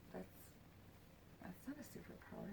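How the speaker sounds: whispered disbelief. Retold or imitated. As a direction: whisper it, doubting her. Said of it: "'Cause I'm like".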